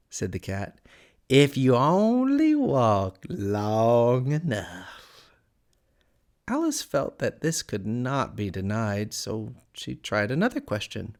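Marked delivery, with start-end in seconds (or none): 1.3-5.3 slow, drawn-out cat character voice; sly Cheshire Cat. A narrator's reading. Cat answering Alice's question, slow and teasing. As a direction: stretch the words out in lazy riddles.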